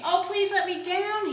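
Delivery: high, loud desperate plea; begging. Acted. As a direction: beg to be let down. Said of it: "he begged".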